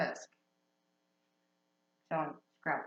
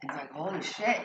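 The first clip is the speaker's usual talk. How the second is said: normal talking voice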